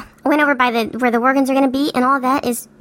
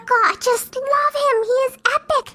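mushy; 11 semitones higher, slower than usual. Pretend. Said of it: "enough of this mushy stuff".

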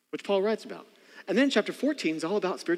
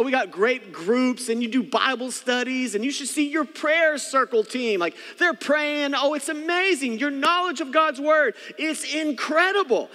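much higher, slow gushing mock praise; sarcastic flattery; 10 semitones higher, 5 dB louder than usual. Paul praising the church's knowledge, high sarcastic gushing. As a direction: gush with exaggerated fake admiration. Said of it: "And then he goes on".

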